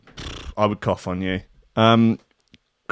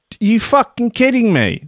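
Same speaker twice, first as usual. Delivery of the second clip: exasperated outburst; 9 semitones higher than usual. Real, not acted. Stream drops out mid show.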